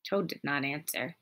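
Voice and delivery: ordinary delivery, nothing marked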